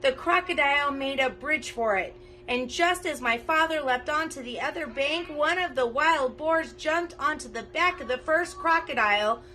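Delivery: ordinary delivery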